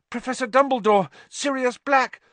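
high, loud urgent cry; urgent appeal. A narrator's reading. Boy appealing to headmaster, urgent. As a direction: blurt it out before being stopped.

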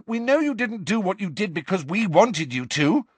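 high, urgent protest; Harry shouting. Acted. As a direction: protest urgently to a crowd that won't listen. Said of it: "Harry shouted".

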